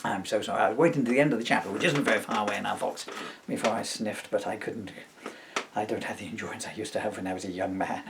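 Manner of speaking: huffing and puffing; winded, catching breath. Real, not acted. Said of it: "I'm just huffing now".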